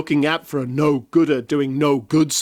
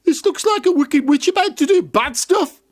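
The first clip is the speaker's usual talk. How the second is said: put-on childlike voice; 11 semitones higher than usual. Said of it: "they never stopped to think".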